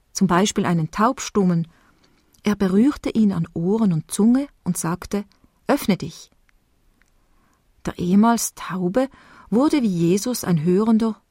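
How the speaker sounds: ordinary talk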